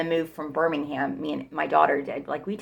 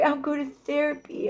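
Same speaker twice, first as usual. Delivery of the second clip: mock whine; 8 semitones higher, 4 dB quieter than usual. Imitating boyfriend's empty promise.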